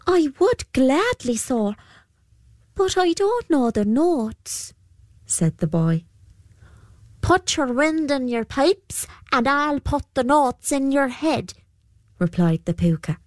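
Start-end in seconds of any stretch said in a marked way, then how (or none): 0.1-4.7 put-on high, timid boy's voice; young boy voice. Boy answers the Púca. 7.2-11.5 creature voice. Acted. Púca replies to the boy.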